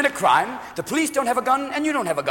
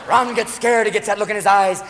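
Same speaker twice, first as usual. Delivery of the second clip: ordinary talk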